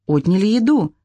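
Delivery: ordinary delivery, nothing marked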